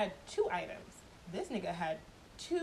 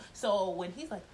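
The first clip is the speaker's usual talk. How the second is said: plain reading voice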